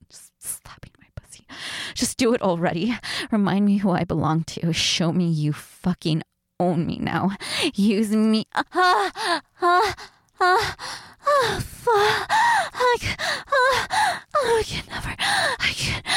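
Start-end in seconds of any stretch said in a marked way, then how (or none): 0.1-1.4 toneless whispered aside. Acted. Hushed aside during roleplay. 8.5-16.0 rising moans. Acted. Moaning builds during roleplay.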